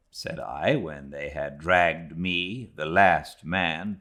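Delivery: usual speaking voice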